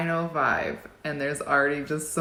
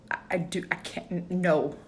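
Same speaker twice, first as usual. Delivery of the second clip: normal talking voice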